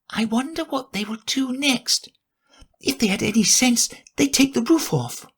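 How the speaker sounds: put-on a curious girl thinking aloud; Alice to herself.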